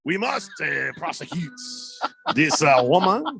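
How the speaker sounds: mock French accent as stuffy police; hammed-up French cop voice, outraged then suspicious. Host mocks French police, faking a French accent. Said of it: "the Frenchy French police say".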